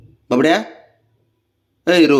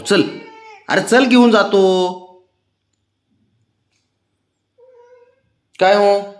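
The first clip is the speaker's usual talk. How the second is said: higher, teasing wheedle; pitched-up, playful pleading with a pet; high coaxing; 8 semitones higher than usual. Real, not acted. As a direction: gently tease him out of sulking. Final coaxing of sulking pet.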